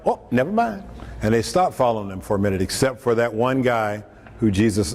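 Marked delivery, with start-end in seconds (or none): 0.1-0.8 high-pitched, comic retreat; mock backing out. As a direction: back out quickly in a higher mock voice. Voices disciples abandoning Jesus.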